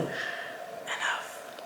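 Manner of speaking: "Enough" whispered to herself; whispered self-reproach. Real, not acted. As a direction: hush yourself, whisper it under your breath. Cuts off her own rambling.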